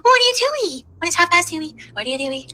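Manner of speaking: high, squeaky mocking voice; mocking a critic. Streamer mimicking a nosy critic, high and squeaky. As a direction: parrot the critic in a silly high whine.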